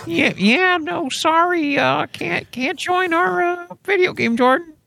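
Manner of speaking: high, halting brush-off; put-on high voice, apologetic excuse; Tim Spooky. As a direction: stall through an awkward refusal in a high voice. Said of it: "Tim Spooky's like".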